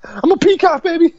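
very high squeaky boast; mock strutting dog; cocky dog voice. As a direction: show off like a peacock. Voicing dog.

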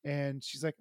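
ordinary talk

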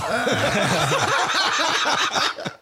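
long, high burst of laughter; big laugh. Real, not acted. Bandmate cracking up over a bass sound joke, laughing. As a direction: crack up helplessly, high-pitched. Laughing at ping pong image.

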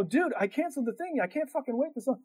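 fed up with the driver; exasperated. Retold or imitated. Telling off the slow driver.

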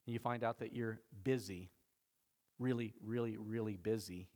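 usual speaking voice

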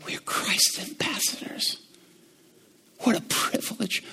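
hushed, breathless awe; reverent whisper. Real, not acted. Preacher to congregation, hushed whisper of awe. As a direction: whisper it like a sacred secret.

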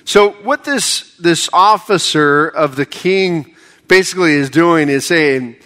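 normal talking voice

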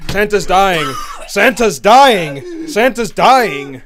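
mock alarm.

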